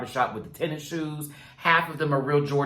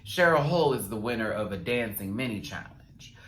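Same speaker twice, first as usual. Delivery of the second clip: ordinary talk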